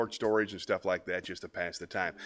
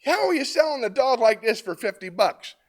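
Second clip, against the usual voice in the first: much higher, louder, slow amazed outburst; astonished buyer; 9 dB louder than usual. Retold or imitated. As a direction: sell the disbelief at the bargain. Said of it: "The man says".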